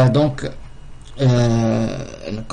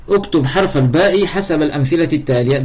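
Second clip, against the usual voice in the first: reading instruction; 4 semitones higher, much faster than usual. Acted. Reads exercise instruction.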